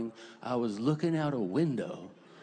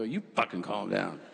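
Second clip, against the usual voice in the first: mock snapping back; 6 semitones lower than usual. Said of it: "I go".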